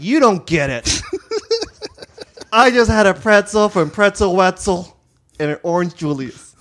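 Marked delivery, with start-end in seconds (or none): 0.0-4.4 louder, sassy snap back; sassy mall teen. Pretend. Teen at the mall tossing his words back at the scout, sassy. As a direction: fling it back at him, louder. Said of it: "And I was like".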